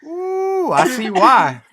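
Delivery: a knowing, delighted squeal; amused surprise. Real, not acted. Hearing one boy had seven sisters, host squeals in amused surprise.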